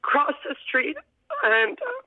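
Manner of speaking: fighting back tears, voice pitching up; emotional, voice breaking. Real, not acted. Runner recalling her best friend at the finish, voice breaking. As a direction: voice cracks up high, close to tears. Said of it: "I'm gonna get emotional talking about this".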